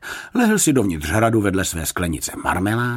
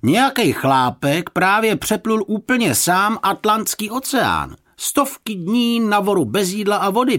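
high, excited shouted news; calling out; 14 semitones higher, 4 dB louder than usual. A narrator's reading. Passer-by shouting news back, calling out. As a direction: holler it back over your shoulder. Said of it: "otočil přes rameno a zavolal".

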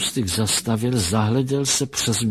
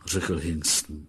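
ordinary delivery, nothing marked

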